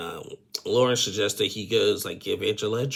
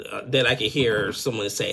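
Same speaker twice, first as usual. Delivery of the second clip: normal talking voice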